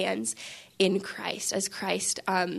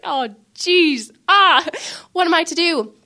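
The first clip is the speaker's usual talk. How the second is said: louder, higher mock panic; flustered exasperation; 8 semitones higher, 7 dB louder than usual. Overwhelmed helper after the boss calls, flustered. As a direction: throw up a loud, helpless groan of a question. Flustered reaction to boss's phone call.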